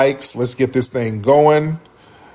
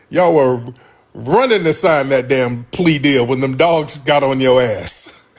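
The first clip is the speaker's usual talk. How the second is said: ordinary delivery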